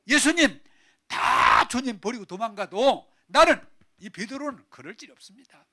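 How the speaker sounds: loud, high, indignant vow; 베드로. Acted. Peter vowing loyalty to Jesus, heated protest. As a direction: declare it forcefully, drawing out the words. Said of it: "베드로가 화를 내면서".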